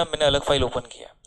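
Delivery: quick whispered side remark; whispered aside. Real, not acted. Teacher whispering an aside to the class.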